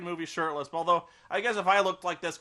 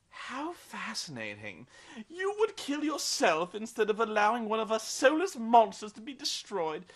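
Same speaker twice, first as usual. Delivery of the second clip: intrigued vampire; 6 semitones higher than usual. Retold or imitated. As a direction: savour it like a curious old vampire. Vampire marvels at Bella.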